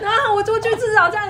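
high, loud burst of laughter into words; laughing. Real, not acted. Laughing as she recalls the staff teasing her. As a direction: laugh hard as you start the line.

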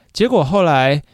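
normal talking voice

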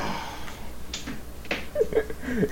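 high, breathy laughing fit; long helpless laugh, mostly breath. Real, not acted. Friend cracks up at the podcast banter, laughing. Amused reaction to a friend's remark.

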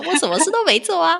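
high, loud laughing disbelief; incredulous protest. Retold or imitated. Host retelling her disbelief at being called great, high and loud. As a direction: protest you did nothing, amused. Retold protesting inner reaction.

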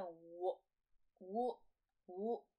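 我 as a rounded wuh, said separately; consonant demonstration. Shown as a demonstration. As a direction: sound out 我 for the letter W, one at a time. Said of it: "英文字母是念我".

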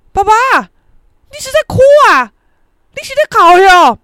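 very loud, high startled yell; shouting. Retold or imitated. Mother to father on seeing tears, shouting. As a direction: yell in astonishment, imitating mum. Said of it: "我妈就叫很大声说".

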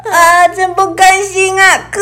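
高声带哭腔的不甘; 懊恼地哭诉; frustrated whine. Acted. (不甘心地哭腔说)

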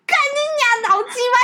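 put-on very high kid cursing in a squeak; child swearing. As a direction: squeak the curse like a tiny angry child. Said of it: "然后就说".

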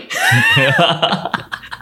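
squealing laugh; high burst of giggling; high burst of laughter. Real, not acted. Laugh mid-argument.

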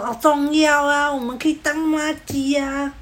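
high, stretched-out buddy-buddy punk voice; wannabe gangster. Retold or imitated. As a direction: imitate a tough guy drawing words out high. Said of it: "还想要学人家当加九".

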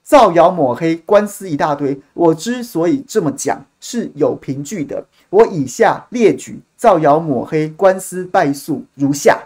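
mock-formal declaiming, loud opening, measured pace; reading out material. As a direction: read out your statement slowly and emphatically. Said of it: "开始把这些资料就开始念".

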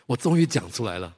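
normal talking voice

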